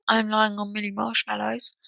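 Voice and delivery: hushed unvoiced aside; whisper. Real, not acted. Podcaster whispering a random confession to listeners. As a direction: whisper it like a secret.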